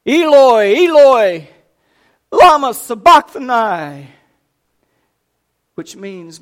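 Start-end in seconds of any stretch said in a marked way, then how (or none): 0.1-4.1 high, loud, drawn-out cry of anguish. A narrator's reading. Jesus on the cross crying out to God, loud anguished cry. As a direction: cry it out with all his strength. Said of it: "cried out with a loud voice".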